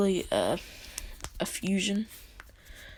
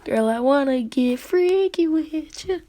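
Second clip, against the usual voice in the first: mock flirty pickup line; 7 semitones higher than usual. Vlogger jokingly hits on a cartoon mom, mock flirty. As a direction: lay on a cheesy pickup voice.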